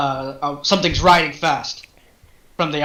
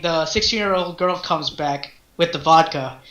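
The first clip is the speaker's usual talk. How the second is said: ordinary delivery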